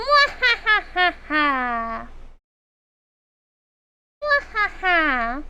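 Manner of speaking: slow hammy villain laugh; evil cackle. Pretend. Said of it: "Being an N tag is fun".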